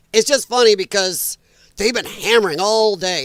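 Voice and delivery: ordinary talk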